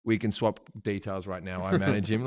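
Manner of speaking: lightly voiced, low and soft; a hint of a discreet manager; manager piping in. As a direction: cut in low with business details. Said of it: "my manager pipes in".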